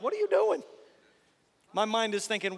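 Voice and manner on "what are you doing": high, alarmed voice of the body; body protesting. Retold or imitated. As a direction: squeak it out like a startled body. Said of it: "my body's like".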